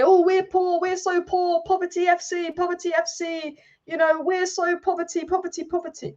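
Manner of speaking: sarcastic pleading-poverty voice; mock poor-me whine. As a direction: ham up their sob story of being broke. Mocking the board's pleading poverty.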